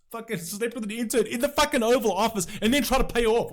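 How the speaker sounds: loud, high furious rant; shouting in disbelief at the hypocrisy; real angry outburst. Real, not acted. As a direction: let the outrage explode. Angry rant about personal scandals.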